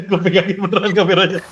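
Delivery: laughing through the words. Real, not acted. Holding the fallen camera for the host, laughing as they speak. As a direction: chuckle while holding the camera.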